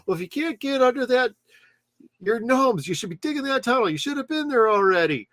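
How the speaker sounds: high-pitched nagging gnome boss; imitated Carl. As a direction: scold the crew in a high put-on voice. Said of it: "and he's like".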